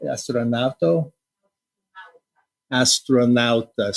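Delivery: "Astronauta" said slowly in Italian, AH-stro-NAU-ta; Italian word. Shown as a demonstration. Host trying out the Italian word for astronaut, slow careful Italian. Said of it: "how do you say astronaut in Ita".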